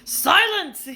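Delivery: loud, high single blast; yell. A narrator's reading. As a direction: shout him down. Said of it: "yelled Uncle Vernon".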